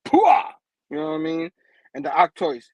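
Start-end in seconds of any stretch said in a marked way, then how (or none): 0.0-0.5 loud, high "pwah" impact noise; imitated comic-book punch; punch sound. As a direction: burst it out like a landed punch. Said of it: "he starts punching them".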